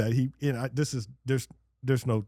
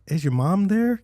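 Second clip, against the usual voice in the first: lightly raised mock phone voice; mock high voice; 6 semitones higher, 4 dB louder than usual. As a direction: tease as if she's a child on the phone. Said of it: "everyone jokes and goes".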